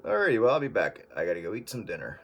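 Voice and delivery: ordinary delivery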